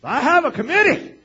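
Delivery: very high, very loud holler; booming pastor impression. Imitating a beloved pastor doing announcements, exuberant shout. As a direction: belt it out like a fired-up preacher. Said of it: "I heard him do his announcements once".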